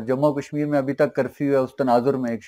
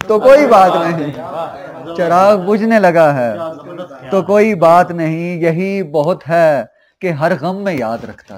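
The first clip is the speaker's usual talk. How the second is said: projected, ringing verse delivery; ghazal recitation; 5 semitones higher, 7 dB louder than usual. Acted. Poet repeating a couplet to a cheering audience, projected recitation. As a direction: declaim the couplet for applause.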